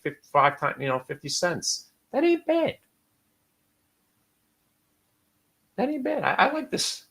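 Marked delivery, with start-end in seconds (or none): 2.1-7.0 squeaky, much higher delight; pleased falsetto musing; high-pitched approval. Real, not acted.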